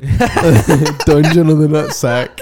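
laughing. Real, not acted. Laughing at channel name.

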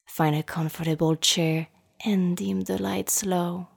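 usual speaking voice

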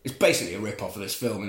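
usual speaking voice

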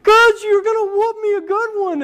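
high mock-tearful whine; imitated crying child. Crying son answering his mom, high mock sobbing. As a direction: blubber it like a little boy, put on. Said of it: "he starts crying".

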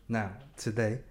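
ordinary talk

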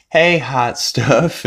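slow, oily come-on; sleazy flirt. Retold or imitated. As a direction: lay on the sleazy charm. Neil flirting with Meg.

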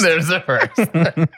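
slow, laughing echo. Real, not acted. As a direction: say it through laughter. Repeats while laughing.

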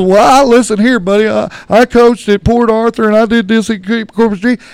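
loud indignant brag; indignant boast. Retold or imitated. Father brags of coaching jobs, loud mock anger. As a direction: puff up and shout your credentials. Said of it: "And he goes".

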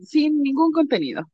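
ordinary talk

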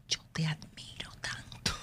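unvoiced whisper, as if from across a room; whispered from afar. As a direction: breathe it out like a mother's secret praise. (susurro lejano, sin voz)

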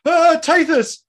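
high, loud startled cry; gasping prisoner. Acted. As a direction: blurt it out in panic.

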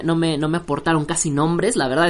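normal talking voice

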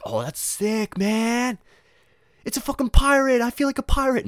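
high, loud, hammed-up delight; thrilled kid. Kid thrilled by parrot on shoulder, excited. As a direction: build into a gleeful mock shout. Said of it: "You'd think".

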